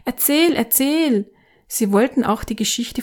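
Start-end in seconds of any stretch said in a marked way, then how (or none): 0.0-1.3 put-on high, slow pleading child's voice; high childlike plea for more; children calling. A narrator's reading. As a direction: stretch it out like begging kids.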